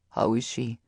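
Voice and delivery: low, soft mate's voice; a lightly voiced deep, gentle man. A narrator's reading. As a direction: speak low and tenderly. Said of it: "voice was deep and yet very gentle".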